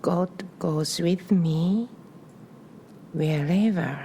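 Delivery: calm meditation voice with long pauses between phrases; guided breathing meditation. Real, not acted. Guiding listeners to inhale and exhale on a prayer, meditative. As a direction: lead the breath gently, phrase by phrase, leaving room after each.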